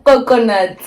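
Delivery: high, loud, amused outburst; tickled amusement. Real, not acted. Friend laughing at the coconut label, tickled. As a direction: relish the silly word, highly amused.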